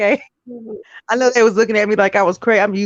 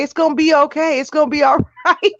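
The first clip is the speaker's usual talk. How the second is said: mock reassurance; 8 semitones higher than usual. Jerry Springer consoling the guy.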